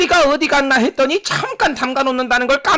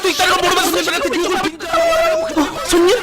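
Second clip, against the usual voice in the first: breathless fast narration; rapid commentary; 2 semitones higher, faster than usual. Acted. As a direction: rattle it off at speed. Fast anime-style commentary. (속사포 해설)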